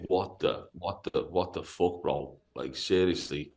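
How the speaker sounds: low, soft, stunned muttering; quiet, halting, retold disbelief. Stunned at man arriving with machete.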